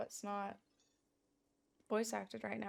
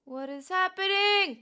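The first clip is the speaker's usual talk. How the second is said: loud, slow, startled question; shocked what is happening; alarmed; 9 semitones higher, 11 dB louder, slower than usual. Real, not acted. Game audio suddenly cuts out.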